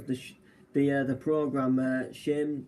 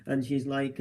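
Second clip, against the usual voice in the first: ordinary talk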